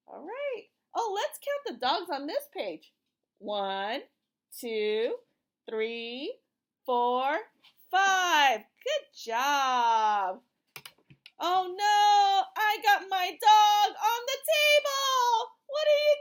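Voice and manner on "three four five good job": ordinary talk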